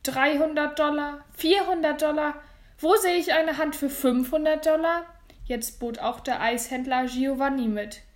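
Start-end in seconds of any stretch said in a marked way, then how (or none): 0.0-5.0 high, loud rallying auction call; ringing questions to the crowd; auctioneer calling bids. Acted. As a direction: drive the bidding up.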